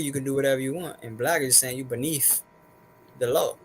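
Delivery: usual speaking voice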